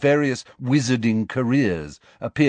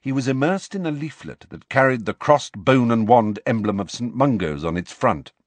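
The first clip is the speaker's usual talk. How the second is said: plain reading voice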